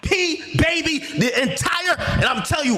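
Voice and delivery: heated shouted oath; furious shouting. Real, not acted. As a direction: rage it out as a vow.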